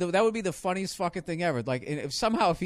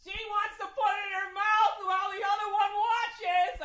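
high, excited play-by-play call; mock announcer; slower than usual. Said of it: "I wouldn't be".

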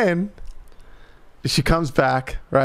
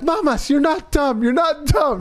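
comforting grandma; 10 semitones higher than usual. Retold or imitated. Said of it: "She's like".

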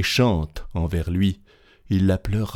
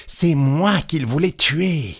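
slow, higher outcry; exclamation indignée; 8 semitones higher, slower than usual. A narrator's reading. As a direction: cry out in slow, high realization. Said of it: "s'écria Petit Claus". (exclamation lente et aiguë)

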